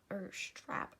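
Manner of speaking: "or strap" breathed as a quick whisper. Real, not acted. Tutor correcting herself under her breath, whispering. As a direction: drop "or strap" to a whisper aside.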